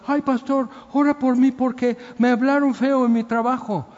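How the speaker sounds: mock a believer crying to the pastor over small slights; whiny believer. Preacher mimics a churchgoer begging the pastor for prayer, high mocking whine. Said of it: "no puedes andar".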